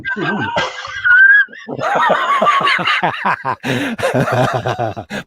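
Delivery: long, high, wheezing laugh; prolonged high helpless laugh. Real, not acted. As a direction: laugh yourself out, high and breathless. After the undercarriage joke.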